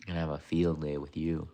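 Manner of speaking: ordinary talk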